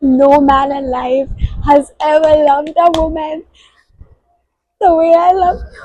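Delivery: giddy fangirl reread; swooning. Real, not acted. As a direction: swoon as you repeat it.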